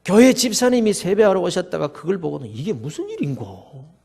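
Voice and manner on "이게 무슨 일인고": low, hushed, bewildered question; understated stunned murmur; astonished bystander. A narrator's reading. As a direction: wonder softly, taken aback. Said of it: "놀라서 눈이 휘둥그레집니다".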